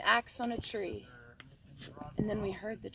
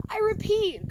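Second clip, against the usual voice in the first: high loud cry; cried out; 12 semitones higher, 7 dB louder than usual. A narrator's reading. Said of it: "cried the Lorax".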